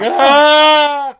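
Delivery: high, loud flustered yelp with a laugh; embarrassed yelp. Retold or imitated. Rejected at the bar, high loud embarrassed yelp. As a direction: let out a panicked squawk. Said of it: "I'm like".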